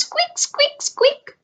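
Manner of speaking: very high tiny squeaks; squeaky voice. Acted. Shopkeeper mimicking the tiny dinos, very high squeaks. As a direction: squeak like a little fluffy dino.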